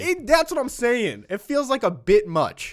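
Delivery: high-pitched, indignant venting; exasperated. Real, not acted. Venting to co-host about the block, exasperated.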